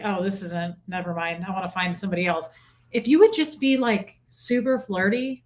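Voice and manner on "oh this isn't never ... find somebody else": low, dismissive mutter to oneself; turned off. Retold or imitated. Woman recalls going off an annoying suitor, dismissive. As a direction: lose interest and brush them off, pitched low. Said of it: "when you get serious and annoying".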